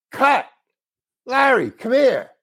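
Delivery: high-pitched mock shout of a director; retold director calling over. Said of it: "he called me over".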